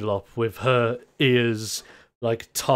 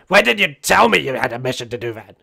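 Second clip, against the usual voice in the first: fast, louder scolding; scolding grandma; 6 dB louder, faster than usual. Acted. Grandma scolding Kickflip about the mission, fast and cross. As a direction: rattle off the reproach. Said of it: "Just go like".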